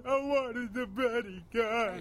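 drawn-out fake sob over a toy; hammed-up fake crying; mock crying. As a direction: whimper it like a sulky kid. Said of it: "I'm not crying".

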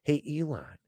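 ordinary delivery

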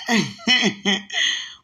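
loud burst of laughter; loud high laugh. Real, not acted.

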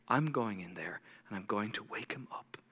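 soft whispered resolve. Retold or imitated. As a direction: breathe it, barely voiced and low. Jesus whispers the miracle.

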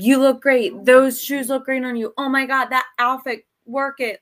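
loud hype-up compliments; acted-out gushing admirer; gushing flattery. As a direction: pile on the praise, loudly. Acting out constant flattery.

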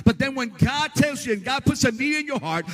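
loud, sing-song revival preaching; full-force preaching. Acted. Preacher rousing congregation about divine need, loud sing-song. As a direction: ride the cadence like a revival preacher.

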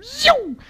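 loud, shooting-up whoosh noise; high-pitched zoom. Retold or imitated. Podcaster mimics double-speed playback, high zooming noise. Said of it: "two X is like".

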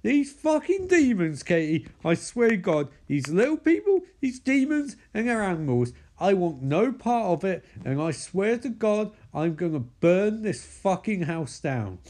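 high, hammed-up ranting; exasperated angry rant. Micah rants to Katie about demons, mock furious. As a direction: swear it emphatically, at the end of your rope.

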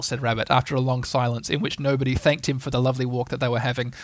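ordinary delivery

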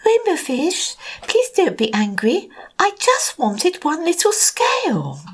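high, gentle pleading voice; little blue fish. Acted.

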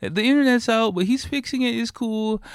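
high mock housewife voice calming her family; imitating the eager lady. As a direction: play it off like nothing's wrong, high and breezy. Voicing the lady reassuring family.

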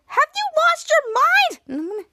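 very high, indignant cry; acted outraged yell. Girl just splashed in the face, outraged yell. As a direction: shriek the question in mock fury.

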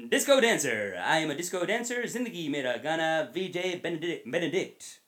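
booming intro voice; radio announcer intro. Pretend. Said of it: "I wanna start".

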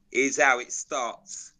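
normal talking voice

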